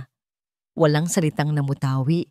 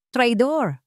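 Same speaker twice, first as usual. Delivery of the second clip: mild shout; 6 semitones higher than usual. A narrator's reading. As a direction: spit out the accusation with a shout. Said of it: "sigaw niya". (sigaw na may galit)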